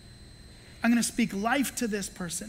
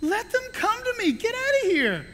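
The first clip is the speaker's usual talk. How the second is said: much higher, forceful outburst; indignant Jesus; 12 semitones higher than usual. Retold or imitated. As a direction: rebuke the disciples hotly. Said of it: "I think he was like".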